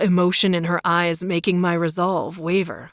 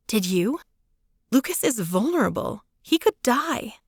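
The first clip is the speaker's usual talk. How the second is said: higher voice, rising to the end; Claire's voice, arguing; 5 semitones higher than usual. A narrator's reading. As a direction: snap back, rising alarm.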